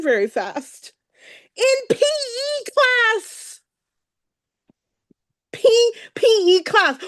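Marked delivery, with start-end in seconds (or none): 1.6-3.6 slow, higher, stretched out; drawn-out high. Real, not acted. Recalling playground beatings, emotional. 5.5-7.0 higher, catching on the words; nearly crying as the memory returns; a real crack of emotion in the voice; voice breaking. Real, not acted. Recalling being beaten, voice catching.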